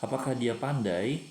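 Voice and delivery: normal talking voice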